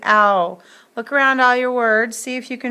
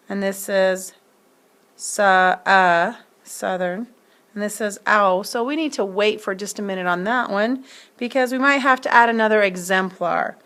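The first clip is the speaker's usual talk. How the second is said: ordinary talk, nothing marked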